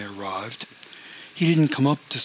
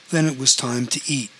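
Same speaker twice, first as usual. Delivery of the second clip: plain reading voice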